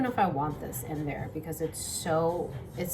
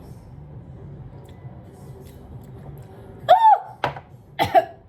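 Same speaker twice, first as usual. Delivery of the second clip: sudden harsh coughing fit; 6 dB louder than usual. Real, not acted. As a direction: cough hard from chili fumes. Chili fumes cause coughing fit.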